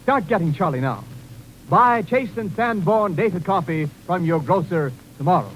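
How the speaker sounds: ordinary delivery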